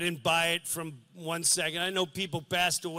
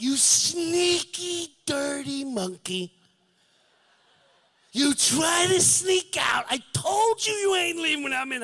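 playful scolding; 10 semitones higher, 4 dB louder than usual. Retold or imitated. Son teasing his dying father for sneaking away, loud and high. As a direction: scold the dying dad like a cheeky child. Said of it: "I'm like".

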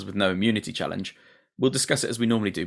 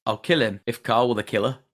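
put-on northern accent. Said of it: "in her, you know, northern accent".

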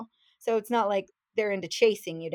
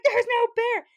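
high, louder exasperated yell; furious insistence; 12 semitones higher, 5 dB louder than usual. Retold or imitated. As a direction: hammer it home, fuming. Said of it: "And she's furious".

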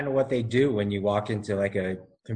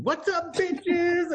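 much higher, whooping call; high greeting shout; much slower than usual. Retold or imitated. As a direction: whoop it like a rowdy buddy. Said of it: "how he just walks in".

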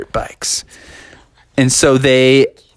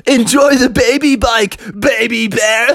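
taunting employees; 9 semitones higher than usual. Acted. Employees taunting Junior about his baby bike, mocking jeer. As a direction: rub it in gleefully. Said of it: "And they're like".

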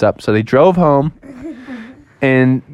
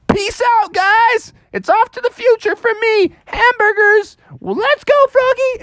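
very high cartoon hamster voice; squeaky hamster voice. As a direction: pitch way up like a tiny rodent. Hamster character voice in story.

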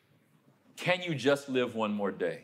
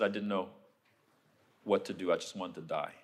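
much softer, voice sinking lower; hushed, painful memory; 8 semitones lower, 7 dB quieter than usual. Real, not acted. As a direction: barely say it, the hurt still there. Recalling abuse, near tears.

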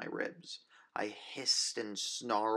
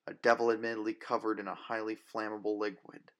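ordinary delivery, nothing marked

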